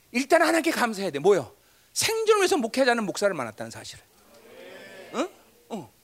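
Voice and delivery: normal talking voice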